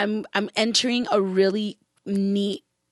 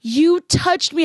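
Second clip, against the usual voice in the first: moved; 6 semitones higher, 3 dB louder, slower than usual. Retold or imitated. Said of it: "she's like".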